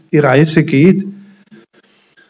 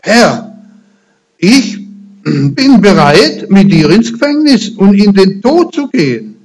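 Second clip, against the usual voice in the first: louder, eager, declaring loyalty; Peter's vow; 4 semitones higher, 3 dB louder than usual. Acted. Peter vowing to Jesus, louder and eager. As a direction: proclaim it like an overeager disciple. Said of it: "Er sagt hier zu Jesus".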